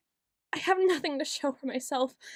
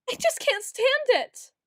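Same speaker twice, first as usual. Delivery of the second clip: strained high outburst; 9 semitones higher, 3 dB louder than usual. Acted. Breaking under the strain.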